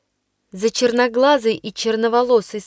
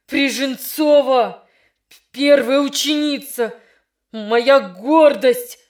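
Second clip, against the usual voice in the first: trembling with anger; 2 semitones higher, slower than usual. Acted. Teacher reproaching his best pupil, slow hurt anger. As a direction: let the anger break through slowly. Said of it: "дрожащим от волнения и гнева голосом".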